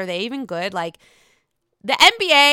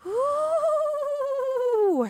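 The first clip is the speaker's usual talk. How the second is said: high, drawn-out ooh; surprised ooh. Real, not acted. Reacting to the article on Jen Shah, a high surprised ooh. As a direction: react to juicy news.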